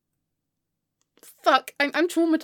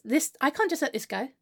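normal talking voice

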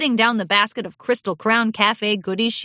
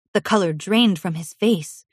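ordinary delivery

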